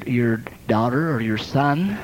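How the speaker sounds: ordinary talk, nothing marked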